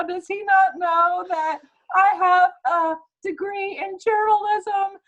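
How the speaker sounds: high, slow, hammed-up fake sobbing; mock sobbing whine. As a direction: whimper it like a caricature hurt by Trump. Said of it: "that guy is like".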